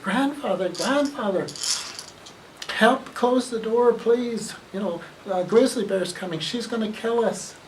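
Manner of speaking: high, urgent plea to grandfather; frightened cubs. Acted. Cubs begging grandfather to shut the door, high and frightened. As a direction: beg for help with the bear close behind.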